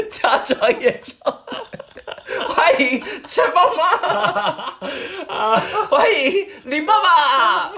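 drawn-out laughing stage intro; mock emcee announcing. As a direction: introduce the acts like a giggling host.

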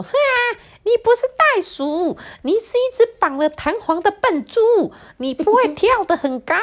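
very high, mocking parrot voice; cackling parrot. A narrator's reading. Parrot exposing the pig's fake kangaroo act, high mocking cackle. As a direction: squawk it high, jeering at a fraud. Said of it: "鹦鹉咯咯的笑说".

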